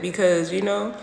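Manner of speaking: ordinary talk, nothing marked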